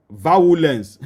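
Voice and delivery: violence said the Nigerian way, "va-wu-lens"; Nigerian pronunciation. Shown as a demonstration. Preacher quoting Nigerian parlance to the congregation, violence as "vawulens". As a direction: say violence as three syllables, va-WU-lens. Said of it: "in our Nigerian parlance".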